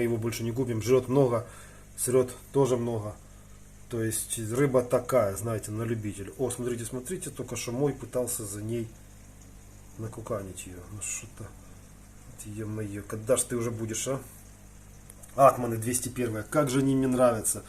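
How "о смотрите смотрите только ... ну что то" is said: usual speaking voice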